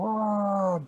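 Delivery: high, long mock yell; acted plunging wail; falling yell. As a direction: cry out as if dropping down. Baldi jumps into the hole.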